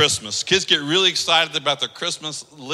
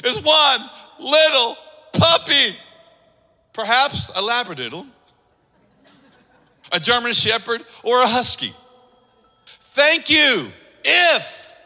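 put-on little girl pleading; 6 semitones higher than usual. Child's letter read aloud.

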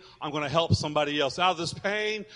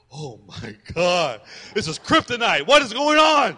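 alarmed; 2 semitones higher, 11 dB louder than usual. Retold or imitated. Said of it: "I'm like".

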